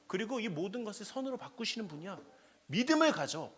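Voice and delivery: mock scolding. Preacher voices scolding pious answer.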